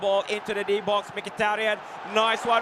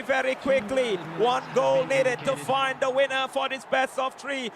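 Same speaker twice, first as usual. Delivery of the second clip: usual speaking voice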